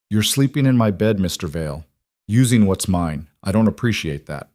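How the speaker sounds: ordinary delivery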